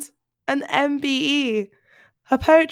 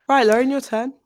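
normal talking voice

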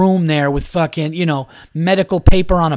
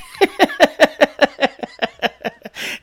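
mock laugh; 10 semitones higher than usual.

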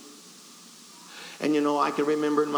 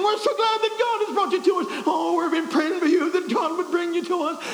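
very high, loud imitation of a welcoming lady; gushing church lady; 13 semitones higher, 4 dB louder than usual. Second lady welcoming the preacher, in a high gushing voice. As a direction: gush it high and loud, mimicking her. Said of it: "she began to say the same thing".

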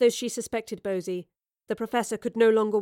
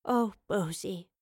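slow, softer sigh of pity; sighed sympathy; 5 dB quieter, slower than usual. A narrator's reading. Professor moving to embrace weeping Bosie, sighed. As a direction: sigh the name out slowly. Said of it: "the professor sighed".

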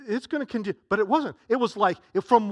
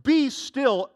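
loud command; 6 semitones higher, 5 dB louder, much slower than usual. Acted. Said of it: "with three words".

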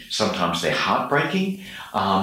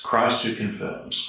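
ordinary delivery

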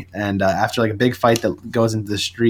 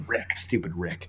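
muttered grumble; 4 semitones lower, 11 dB quieter than usual. Real, not acted. Fan complains about writer.

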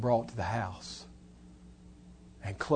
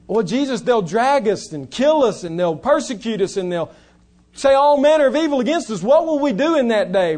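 anxious disciple; 9 semitones higher, 13 dB louder than usual. Retold or imitated. Disciples protesting to Jesus.

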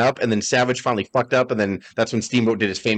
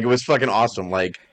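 ordinary talk, nothing marked

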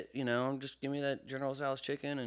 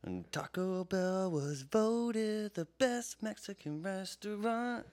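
slow, drawn out; 4 semitones higher, much slower than usual. Pretend. Drawn-out announcement of fact.

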